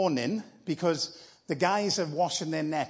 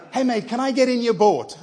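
breezy, louder mate-to-mate ask with a laugh; casual blokey Jesus; 4 semitones higher, 6 dB louder than usual. Acted. Jesus asking fisherman Peter to use his boat, breezy and laughing. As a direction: toss it off like asking a mate a favour. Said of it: "I don't know if he spoke like this".

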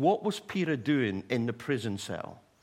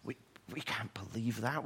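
hushed, disbelieving whisper; doubting disciples, whispering in shock; stunned whisper; 3 semitones lower, 6 dB quieter than usual. Retold or imitated. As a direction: barely dare to say it. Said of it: "the disciples were going".

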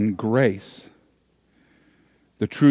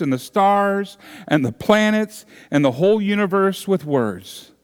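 rising preaching fervor; 5 semitones higher than usual. Real, not acted. Preaching builds to a climax.

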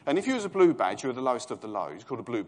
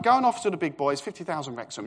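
boss order; 6 semitones higher, 7 dB louder than usual. Retold or imitated. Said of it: "He'd say something like".

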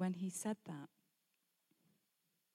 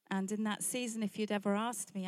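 normal talking voice

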